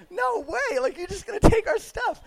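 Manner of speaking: high-pitched, incredulous mock protest; mock disbelief. Tourist balking at stranger's offer to store bags, high mock disbelief. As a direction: squeak out a disbelieving objection.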